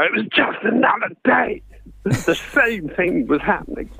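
high, lively story read; manic storytelling. Shown as a demonstration. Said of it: "give it some welly".

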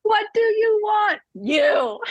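high shouted movie line; Notebook movie quote. Retold or imitated. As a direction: shout it like the rain scene. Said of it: "Noah from The Notebook".

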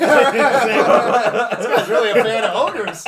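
slow words through laughter; laughing. Real, not acted. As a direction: say it while cracking up. Laughing remark.

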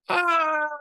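high, loud scared cry; frightened yelp. Acted. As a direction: shriek in sudden fright. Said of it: "yelled Serotonin".

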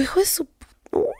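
slow, soft whispered swear; hushed furious whisper; whispered curse. Retold or imitated. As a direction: bite back an insult under your breath. Stifled curse at post.

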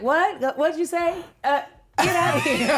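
high, laughing brush-off; dismissive husband. Retold or imitated. Wife imitating her husband brushing her off, laughing.

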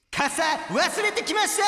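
high, drawn-out, full-volume proclamation; shouted report. Pretend. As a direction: scream the mishap like big news. Shouts listener's report climax. (失敗談を高く長く絶叫)

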